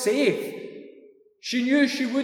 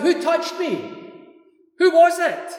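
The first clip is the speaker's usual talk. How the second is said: raised, high calling shout; cries out; 6 semitones higher, 5 dB louder than usual. Retold or imitated. Jesus calling out to the jostling crowd, loud high shout. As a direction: call out over a crowd, demanding. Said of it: "he cries out".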